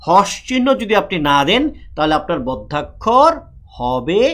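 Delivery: usual speaking voice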